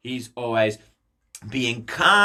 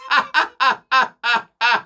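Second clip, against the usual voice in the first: high, loud scheming cackle; villain laugh; 6 semitones higher, 3 dB louder than usual. Acted. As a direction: cackle like a plotting prince. Villain laughs after claiming crown.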